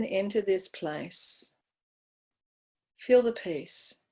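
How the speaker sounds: guided meditation. Acted.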